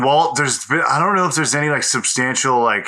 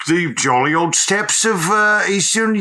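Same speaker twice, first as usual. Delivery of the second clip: put-on Cockney football hooligan accent; mock Cockney hooligan; 5 semitones higher than usual. Cockney Genghis Khan in a spoof biopic. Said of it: "a fucking football hooligan guy".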